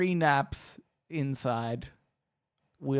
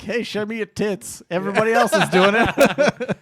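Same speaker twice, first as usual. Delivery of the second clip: pushy bot voice; 5 semitones higher, 10 dB louder than usual. Retold or imitated. Mimicking a pushy dating bot.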